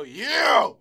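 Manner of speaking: put-on loud, high drunken holler; drunk cheer. Two drunk guys high-fiving at the bar, loud cheering holler. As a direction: imitate drunk buddies yelling in triumph. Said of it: "that drunk thing".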